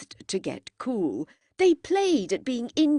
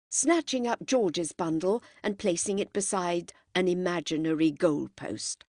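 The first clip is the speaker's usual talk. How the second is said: ordinary delivery, nothing marked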